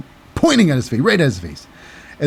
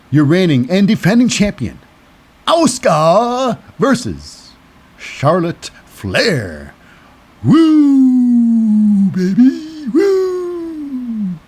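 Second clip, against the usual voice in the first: ring announcer; 7 semitones higher, much slower than usual. Pretend. Ring announcer, Flair woo.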